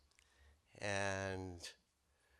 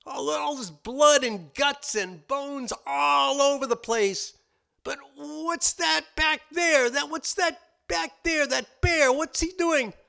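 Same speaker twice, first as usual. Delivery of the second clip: very high, loud, slow amazement; horrified wolf; 11 dB louder, slower than usual. Acted. Wolf staring into the cave at blood and a bear, very high and slow. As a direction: gasp at the gore and the bear.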